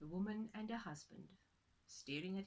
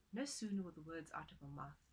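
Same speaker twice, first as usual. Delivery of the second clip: ordinary delivery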